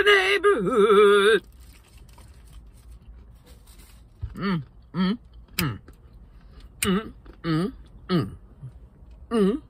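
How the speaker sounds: humming while chewing; muffled closed-mouth sounds; mouth full, chewing. Real, not acted. Seen 0: Lips closed and working between bites; dip container in hand.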